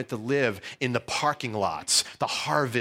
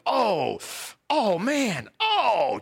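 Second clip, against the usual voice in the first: dismayed oh; 11 semitones higher, much slower than usual. Retold or imitated. Kids watching the beating.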